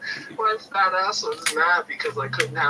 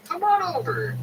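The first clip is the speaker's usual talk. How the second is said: high, lightly voiced old man calling over; beckoning old vendor. Old seller waves the visitor to his booth, high mock call. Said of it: "and he just kept".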